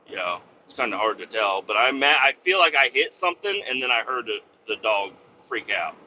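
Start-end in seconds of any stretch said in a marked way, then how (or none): none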